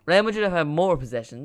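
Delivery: genuinely astonished exclamation; loud disbelief. Real, not acted. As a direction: blurt out the stat in disbelief. Reacts in disbelief to possession stats.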